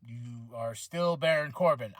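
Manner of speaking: low, slow unimpressed line; flat deadpan disbelief. Retold or imitated. Fan unimpressed by Corbin's new look, low deadpan. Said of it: "I was like".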